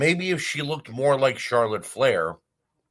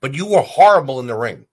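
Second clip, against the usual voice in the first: angry shout; 4 semitones higher, 9 dB louder than usual. Real, not acted. Ranting directly at Lana.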